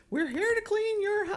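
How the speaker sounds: high, chirpy cleaner voice announcing cleanup; high mock cleaner. Cleaners arriving at her house, high chirpy voice. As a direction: play a sing-song cleaning crew.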